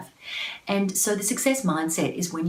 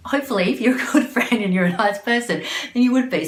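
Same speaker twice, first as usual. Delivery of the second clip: usual speaking voice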